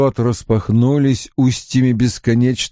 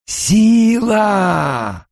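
booming shout; 9 semitones higher than usual. Acted. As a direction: thunder the word. Villain shouts Power.

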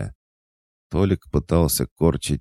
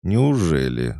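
higher, louder mocking question; malicious smirk; 5 semitones higher, 5 dB louder, slower than usual. A narrator's reading. Owner mocks the cat's refusal, maliciously amused. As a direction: taunt with a gleeful, wicked smirk. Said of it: "злобно усмехнулся я".

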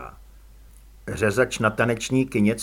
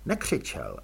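ordinary delivery, nothing marked

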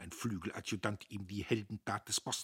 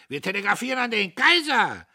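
unruly triumphant shout; 13 semitones higher, 13 dB louder than usual. Acted. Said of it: "aus Rand und Band".

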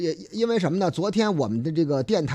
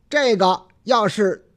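usual speaking voice

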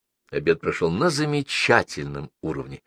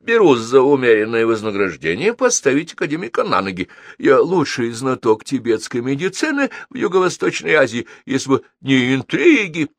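high, loud self-promoting pitch; fervent diplomat selling his services; excitable consul, boastful; 7 semitones higher, 5 dB louder than usual. Acted. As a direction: boast eagerly in a raised voice.

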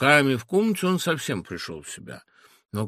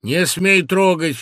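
soldier's order; 5 semitones higher, 8 dB louder, slower than usual. A narrator's reading. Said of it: "вмешивает солдат с пикой".

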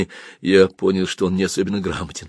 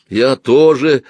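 louder, raised, abrupt start; 6 semitones higher, 7 dB louder, much slower than usual. Acted. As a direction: begin abruptly, then break off. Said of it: "отрывисто начал был я". (отрывисто)

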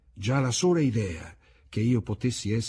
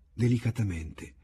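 ordinary delivery

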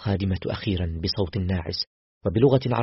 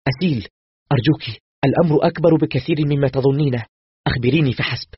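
higher, louder frightened begging; desperate, pleading for trust; panicked plea; 5 semitones higher, 7 dB louder than usual. A narrator's reading. Doctor to Aseel at the intercom, panicked pleading. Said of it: "فسألتها بذعر".

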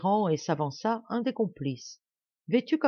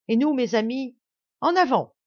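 rising battle cry, louder and higher to the end; rally the men, charge forward; 6 semitones higher, 6 dB louder than usual. A narrator's reading. Marie-Anne rallies the men.

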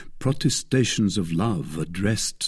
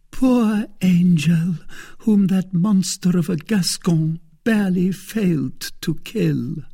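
pitying Milady; 10 semitones higher, 4 dB louder than usual. A narrator's reading. Milady voiced in read story.

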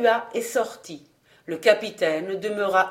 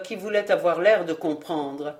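ordinary delivery

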